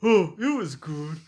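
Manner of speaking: mock gushing voice. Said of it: "When I say".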